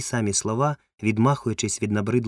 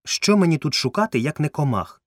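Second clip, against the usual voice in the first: higher, louder irritated retort; indignant rhetorical question; angry; 7 semitones higher, 5 dB louder than usual. A narrator's reading. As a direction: retort testily, pitched up. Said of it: "сердито відказав кузен Бенедікт".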